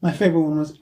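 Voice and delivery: normal talking voice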